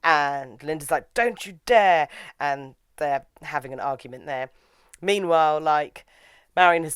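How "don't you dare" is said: higher, louder acted objection; mock indignant warning. Linda objects to the proposal, mock angry warning. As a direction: imitate an indignant rival. Retold argument.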